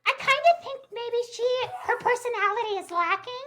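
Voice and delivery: high-pitched, playful put-on voice; squeaky mock gossip; mock catty. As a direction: deliver a teasing dig in a silly high voice. Co-host mock-disparages the other guest.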